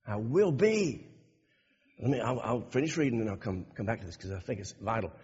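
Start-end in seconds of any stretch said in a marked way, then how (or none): 0.1-1.1 slow "I WILL be", "will" held and stressed. Shown as a demonstration. Preacher repeating the promise with "will" stressed. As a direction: lean hard on "will", unhurried. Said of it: "or, I will be with you?".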